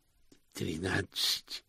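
slow, soft, quavering answer; trembling voice. Acted. Hubert dazed after two slaps, trembling voice. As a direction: draw it out shakily and quietly. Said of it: "спросил он дрожащим голосом".

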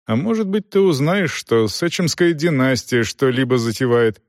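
usual speaking voice

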